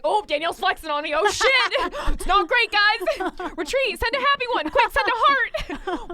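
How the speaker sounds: high, loud, frantic hammed-up alarm; mock panic. Pretending to panic over a cohost's flex emoji, hammed-up. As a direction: scramble to make peace, half laughing.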